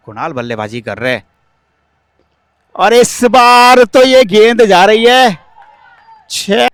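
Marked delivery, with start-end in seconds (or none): 2.7-6.7 loud, high build to a six; excited cricket commentary. Real, not acted. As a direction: ride the ball over the rope. Ball flies for six.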